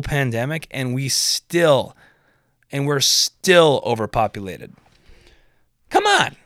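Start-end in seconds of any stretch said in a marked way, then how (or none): 5.9-6.4 high, loud "come on" of disbelief; exasperated. Real, not acted. Ranting comic to viewers, exasperated high cry. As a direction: throw the words up in exasperation. Exasperated about overpopulation rant.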